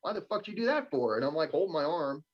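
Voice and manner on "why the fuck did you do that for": higher, indignant mock outrage at the jab; cussing in shock. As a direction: retell the swearing, pitched up and fast. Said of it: "sitting there cussing".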